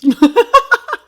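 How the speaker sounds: high, loud cackling laugh; real uncontrolled laugh; loud burst of laughter. Real, not acted. Cracking up at the nail talk.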